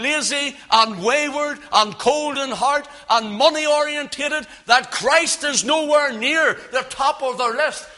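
urgent pulpit exhortation; preach. Real, not acted. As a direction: drive the rebuke home, high and insistent. Preaches at full force.

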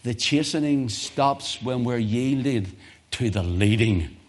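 slowed, deliberate restatement; emphatic slow sermon repeat. Shown as a demonstration. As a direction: lay each word down slowly. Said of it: "I'm gonna say it again".

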